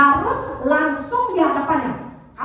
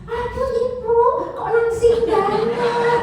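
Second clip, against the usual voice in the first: "kok langsing banget" stretched very slow and higher; over-the-top praise; 8 semitones higher, 3 dB louder, much slower than usual. Shown as a demonstration. Mocking a fake compliment to a heavy woman, gushing. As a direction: gush fake flattery to a lady. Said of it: "jangan memuji lebay".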